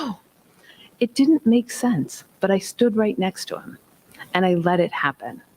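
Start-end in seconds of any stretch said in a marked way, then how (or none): 0.0-0.2 put-on upset child. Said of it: "and he screamed".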